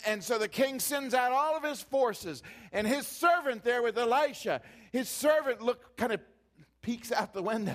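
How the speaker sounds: loud, high storytelling from the pulpit; preached story. Acted. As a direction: tell it big to the crowd.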